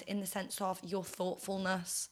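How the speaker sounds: ordinary talk, nothing marked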